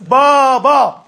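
very slow, drawn-out "بابا"; a child proudly saying "بابا" for the first time; baby's first word. Retold or imitated. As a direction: stretch it like a toddler's first try.

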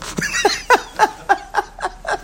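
long silent wheezing laugh; breathy unvoiced laughter. Real, not acted. Preacher laughing with the congregation, breathy laugh.

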